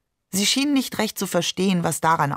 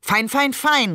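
higher-pitched, sharp outburst; exasperated call; 6 semitones higher than usual. A narrator's reading. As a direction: throw the repeated word out impatiently. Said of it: "rief Barbe". (aufgebracht gerufen)

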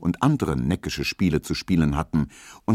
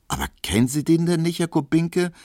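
much higher and fast, an eager exclamation; calling out; 10 semitones higher than usual. A narrator's reading. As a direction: blurt it out in quick surprise. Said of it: "rief Pauline". (schneller, hoher Ausruf)

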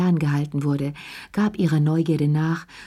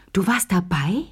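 astonished; 3 semitones higher than usual. Acted. Lea repeats her question to Chérie, openly amazed. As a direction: repeat it aloud in disbelief. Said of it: "Sie hörte auf zu lachen".

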